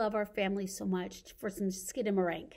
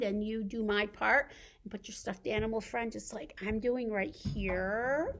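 normal talking voice

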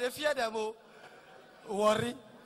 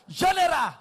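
loud high call of General; shouted greeting; 5 semitones higher, 10 dB louder than usual. Retold or imitated. A guy near the army hailing him, shouted. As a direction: call out to him across the room. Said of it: "see the shout".

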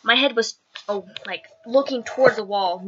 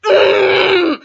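high loud whining mm; a pouting protest sound; indignant whine; 8 semitones higher, 8 dB louder than usual. Retold or imitated. Indignant noise at death stare.

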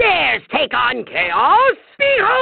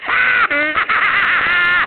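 evil cackle; 3 semitones higher than usual. Acted. Villain gloats.

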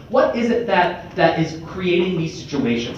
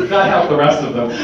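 ordinary delivery

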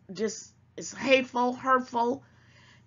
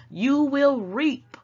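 each word stretched out slow and louder; slow emphatic warning; 5 semitones higher, 6 dB louder, much slower than usual. Real, not acted. Warning viewers that they will reap what they sow, slow and emphatic. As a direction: hammer the warning home, word by word.

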